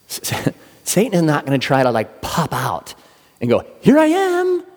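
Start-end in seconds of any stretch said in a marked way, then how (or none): 3.8-4.6 high, loud sing-out; mock jump-scare. Satan pretending to pop out and scare, hammed-up high call.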